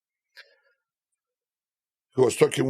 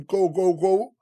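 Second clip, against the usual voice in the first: shooing away; 8 semitones higher, slower than usual. Retold or imitated. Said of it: "I was telling him".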